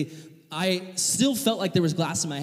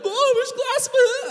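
put-on panicked kid cry; 9 dB louder than usual. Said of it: "I'm like".

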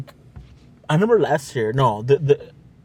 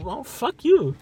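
mock snotty retort; 10 semitones higher, much slower than usual.

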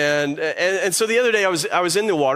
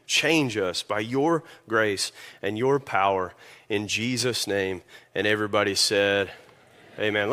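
softer, lower prayer voice; 5 semitones lower, 4 dB quieter than usual. Acted. Pastor praying over the congregation, soft and low. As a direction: pray gently and earnestly, as if to God.

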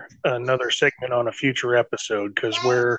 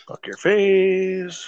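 slow, drawn-out taunt, higher; mock taunt; 6 semitones higher, much slower than usual. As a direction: stretch each word out to tease. Taunting teammate in game.

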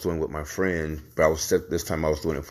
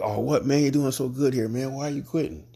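much higher, incredulous "what, man?"; surprised boss; 9 semitones higher than usual. Retold or imitated. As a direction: jump up high in disbelief at the news. Boss shocked employee quits.

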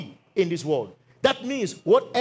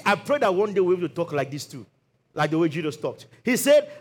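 ordinary talk, nothing marked

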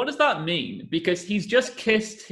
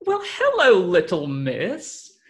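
posh formal greeting; 3 semitones higher, 3 dB louder, slower than usual. Shown as a demonstration. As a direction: greet the dog like a well-bred little girl. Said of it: "like they're posh children".

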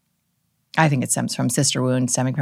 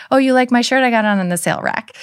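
higher, faster nervous gush; mock self-deprecating chatter; deflecting compliment; 8 semitones higher, 4 dB louder, faster than usual. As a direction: rush to undercut the praise.

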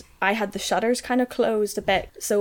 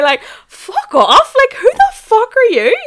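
outraged; 11 dB louder than usual. Retold or imitated. Outraged retort to boss.

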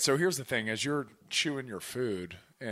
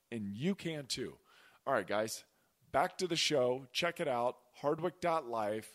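upbeat host ad read, a little higher; sponsor ad read; 4 semitones higher than usual. Acted. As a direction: pitch the program like a friendly sponsor spot. Host reads podcast sponsor ad.

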